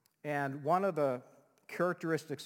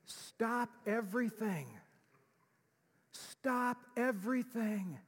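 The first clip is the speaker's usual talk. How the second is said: higher, slow "stop everything" with "stop" stressed; slow emphatic command; 7 semitones higher, slower than usual. Shown as a demonstration. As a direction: spell out "stop everything" slowly. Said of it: "The word behold means".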